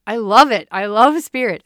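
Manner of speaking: loud, thrilled burst; excited. Real, not acted. To listeners, loud excited love for spirit. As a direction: gush with delight.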